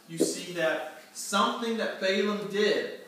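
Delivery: ordinary talk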